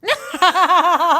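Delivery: high loud burst of laughter; laughing. Real, not acted. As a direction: crack up at the punchline. Laughing at the story.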